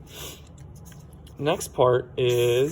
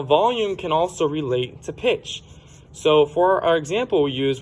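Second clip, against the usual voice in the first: ordinary talk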